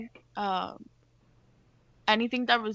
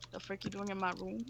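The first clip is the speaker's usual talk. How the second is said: quiet disbelief; 2 semitones lower, 15 dB quieter than usual. Acted. Character finds visitor in her own room, muttered disbelief. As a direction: say it very softly, under your breath. Acted aside, looking her up and down.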